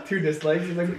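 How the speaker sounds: ordinary delivery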